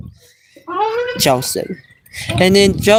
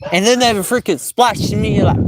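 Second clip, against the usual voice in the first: usual speaking voice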